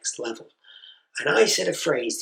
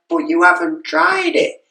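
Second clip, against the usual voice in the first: louder, pleading, higher; exasperated parent; 6 semitones higher, 8 dB louder than usual. Retold or imitated. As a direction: push it, losing patience.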